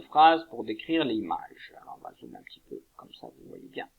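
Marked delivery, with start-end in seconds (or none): 1.8-3.9 whispered aside. Real, not acted. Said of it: "aside while zooming".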